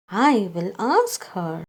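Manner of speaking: ordinary delivery, nothing marked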